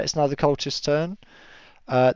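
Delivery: ordinary talk, nothing marked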